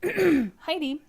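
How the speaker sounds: calling out high and loud to the dog. Real, not acted. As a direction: sing out the puppy's name across the room. Calling her dog mid-greeting.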